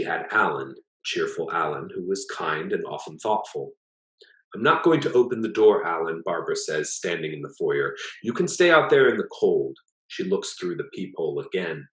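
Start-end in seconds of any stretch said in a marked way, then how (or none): 4.5-6.2 loud, high, defiant refusal; firm refusal. A narrator's reading. Barbara refusing to open the door to Alan, loud and firm. 8.2-9.8 loud, high, defiant refusal; firm refusal. A narrator's reading. Barbara refusing to open the door to Alan, loud and firm.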